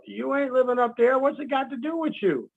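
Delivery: ordinary talk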